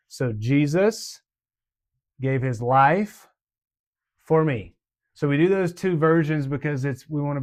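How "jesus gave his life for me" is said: slow, broken into spaced phrases, a bit louder; slow, phrase by phrase for the toddlers; slow with motions. Shown as a demonstration. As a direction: spell it out for little ones. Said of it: "This is for the walkers".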